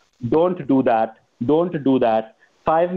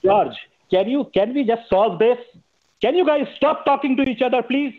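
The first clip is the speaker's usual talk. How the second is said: high-pitched exasperated plea; strained, much higher-pitched appeal; raised voice; 9 semitones higher than usual. Real, not acted. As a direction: plead in a raised pitch, losing patience without shouting. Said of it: "if he ever yelled at George".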